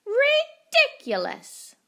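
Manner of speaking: very high, loud, slow scoff; duck's shrill scoff. A narrator's reading. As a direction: scoff like a snooty duck. Duck scoffs at tortoise.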